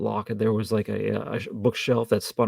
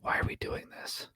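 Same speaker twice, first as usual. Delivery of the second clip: barely audible whisper to her; imitated whisper; 12 dB quieter than usual. Co-host jokingly voices a man whispering to a woman, hushed whisper. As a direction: whisper it, as if meant for one listener. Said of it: "barely hear him whisper to her".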